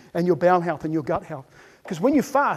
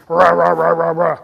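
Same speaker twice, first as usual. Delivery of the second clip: loud, low "rah-rah" munching; greedy gobbling noise; 4 semitones lower, 7 dB louder than usual. Acted. Speaker imitating humans eating, loud greedy gobbling. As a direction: gobble noisily on one low note. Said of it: "we're eating food".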